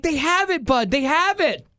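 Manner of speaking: wife telling her husband, thrilled; excited. Retold or imitated. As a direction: share the good news gleefully. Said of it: "she's so excited".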